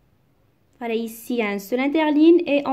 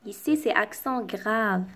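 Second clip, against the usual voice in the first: ordinary delivery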